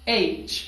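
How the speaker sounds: एच ending cleanly in ch; correct H. Shown as a demonstration. Teacher gives students the correct एच. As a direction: say एच as aych. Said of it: "आपने इसको pronounce करना है एच".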